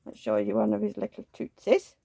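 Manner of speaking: usual speaking voice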